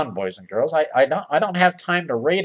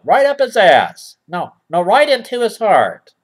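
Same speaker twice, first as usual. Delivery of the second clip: usual speaking voice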